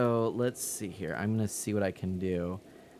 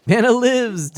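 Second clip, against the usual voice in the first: high excited read; 13 semitones higher, 13 dB louder than usual. Real, not acted. Reading chat name aloud.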